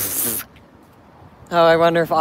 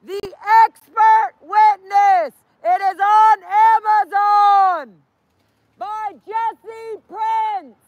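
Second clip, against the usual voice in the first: high, loud, slow street callout; drawn-out, projected protest yell; protest shout; 13 semitones higher, 6 dB louder, much slower than usual. Real, not acted. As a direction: bellow each word to the street. Shouts book plug to the street.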